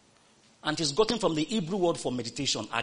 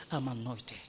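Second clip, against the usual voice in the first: telling yourself quietly; 6 semitones lower, 9 dB quieter than usual. Shown as a demonstration. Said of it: "you tell yourself".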